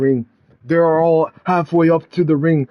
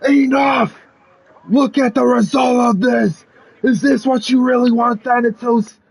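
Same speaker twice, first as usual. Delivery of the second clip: acted yell; 7 semitones higher than usual. Lewis Hendricks confronts Thanatos over the war, yelling. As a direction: plead angrily at a raised pitch. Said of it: "then goes to him and yells".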